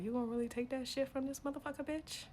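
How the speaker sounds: soft, quick needling voice; hushed instigator, pitch creeping up to the end; goading whisper in ear. Acted. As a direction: egg them on quietly, close to the ear. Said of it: "she gonna whisper in my ear".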